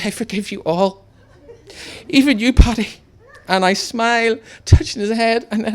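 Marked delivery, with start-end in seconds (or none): 0.0-2.9 high, loud theatrical martyr; hammed-up deathbed blessing; mock deathbed forgiveness. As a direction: play the dying saint. Imagined deathbed speech.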